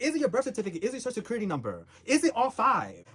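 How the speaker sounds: high, loud exasperated rant; rapid-fire indignant questions, pitched high; crashing out. Real, not acted. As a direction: fire off the questions, losing patience. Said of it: "I'm crashing out".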